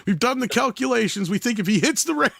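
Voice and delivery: high-pitched mock stunt announcer; stunt-show host. Kids filming their own stunts, mock host announcement. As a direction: announce it like a homemade stunt video.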